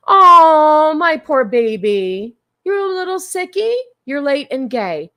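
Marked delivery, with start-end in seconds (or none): 0.1-3.7 put-on baby talk. Cooing at a sick pet.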